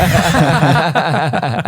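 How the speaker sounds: big high burst of laughter. Real, not acted. Host laughs at joke.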